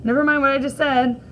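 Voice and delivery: usual speaking voice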